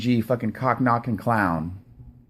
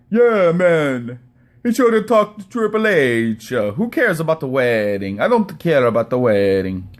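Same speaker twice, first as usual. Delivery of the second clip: Don Tony. Retold or imitated. Host mimicking podcaster's wedding complaint, mocking put-on voice. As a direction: mimic him with a dopey, exaggerated delivery. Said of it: "Don Tony's like".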